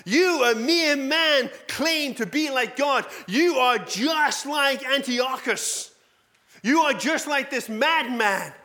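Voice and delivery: angry crowd yell. Retold or imitated. Preacher voicing the crowd condemning Jesus, a loud, high mock shout. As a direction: hurl the charge like a mob raising stones. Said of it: "they yell out".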